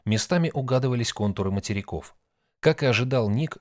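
plain reading voice

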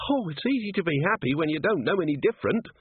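high-pitched, bitter complaint. A narrator's reading. Cheery answering Angua about lady dwarfs, bitter. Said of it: "said Cheery bitterly".

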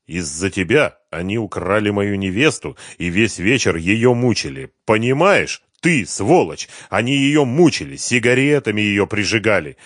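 loud, mounting reproach; angry accusation. A narrator's reading. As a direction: hammer out each charge with force. Victim angrily accuses the cowering man.

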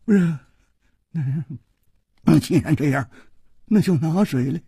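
halting, weepy surrender; tearful. A narrator's reading. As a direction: give in through tears, in fragments. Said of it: "流着泪说".

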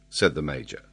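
ordinary delivery, nothing marked

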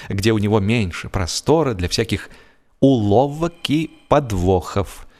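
plain reading voice

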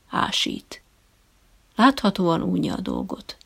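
ordinary delivery, nothing marked

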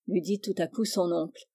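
ordinary delivery

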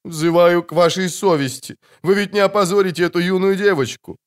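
pleading matron. A narrator's reading. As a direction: beg them, shocked and proper. Matron begs the bandits.

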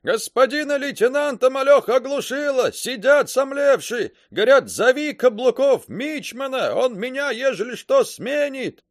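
high, loud yelling over battle noise; sailor's yell. A narrator's reading. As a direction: shout it over the guns. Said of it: "заорал Каблуков".

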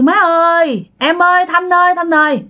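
high, sustained call to the household. Acted. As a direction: call for mother and wife on arriving home. Son calls out on coming home. (gọi lớn giọng cao khi về nhà)

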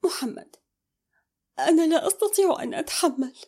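high-pitched, distressed plea; tearful. A narrator's reading. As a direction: break down as if near tears. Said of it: "قالتها أمل بصوت باكٍ". (بصوت باكٍ)

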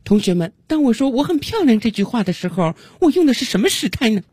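high-pitched teacher addressing a class; 语法老师. Acted. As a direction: put on a high teacher voice, quizzing pupils. Acted grammar teacher questioning class. (高音调的老师提问腔)

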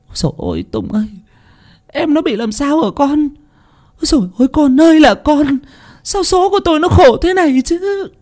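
a mother keening over her daughter, pitch up high; wailing. Acted. Aunt wailing to Tùng at the hospital, high keening lament. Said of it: "nắm tay Tùng khóc lóc".

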